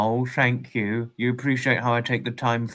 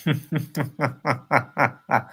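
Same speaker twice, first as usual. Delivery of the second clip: steady, rhythmic string of chuckles; real chuckle; 2 semitones higher than usual. Real, not acted. Reacting to Denise's message, amused laughter. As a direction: chuckle along in even, separate pulses.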